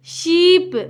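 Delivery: शीप with a drawn-out ee; long ee. Shown as a demonstration. Teacher reading a double E word, stretched शीप. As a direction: hold the vowel in शीप before the p. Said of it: "थोड़ा लंबा होगा".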